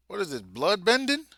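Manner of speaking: high, loud, stunned question about "blood bending"; shocked disbelief. Real, not acted. Reader stunned by a blood attack, shocked high yelp. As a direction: blurt it out in total surprise. Shocked reacting to a comic panel.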